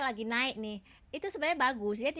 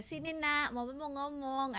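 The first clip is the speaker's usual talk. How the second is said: too sweet; 5 semitones higher than usual. Shown as a demonstration. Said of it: "Ah, itu bukan marah ya".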